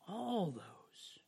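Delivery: hushed whisper. Real, not acted. Preacher reading Acts to the church, whispering. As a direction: drop it to a whisper for emphasis.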